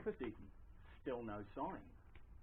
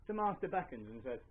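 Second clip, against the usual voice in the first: plain reading voice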